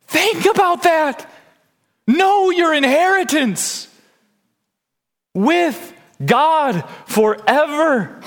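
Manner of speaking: high, drawn-out exhortation; impassioned preaching. Real, not acted. Preacher urging the congregation to know their inheritance, fervent exhortation. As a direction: stretch each word high and emphatic.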